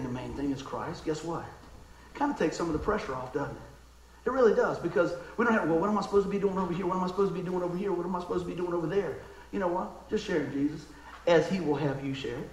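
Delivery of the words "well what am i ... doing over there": rattled off quickly in a run; rapid-fire, evenly repeated worrying; flustered questions. Acted. A pressured believer asking what to do, flustered and quick.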